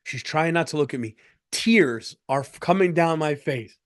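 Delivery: ordinary talk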